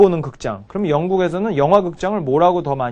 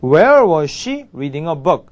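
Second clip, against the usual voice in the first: slow, clear teacher demo; each word laid out slowly; slow model sentence; much slower than usual. Shown as a demonstration. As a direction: spell it out slowly for learners. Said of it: "이렇게 되겠죠".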